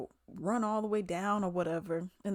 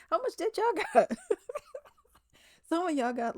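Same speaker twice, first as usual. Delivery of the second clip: laughing; 10 semitones higher, 6 dB louder than usual. Real, not acted. Laughing at contestants in debt.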